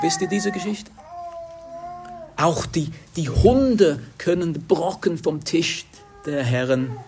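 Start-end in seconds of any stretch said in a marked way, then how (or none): 2.4-7.0 woman's plea. Retold or imitated. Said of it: "sie sagt".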